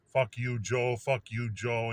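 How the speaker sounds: ordinary delivery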